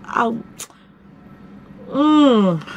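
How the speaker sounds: ordinary talk, nothing marked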